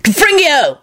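high spell shout. A narrator's reading. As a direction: fire it off. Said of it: "he shouted".

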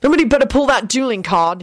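understated threat, lifted in pitch; dark warning. A narrator's reading. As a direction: warn everyone off. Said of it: "informed them all darkly".

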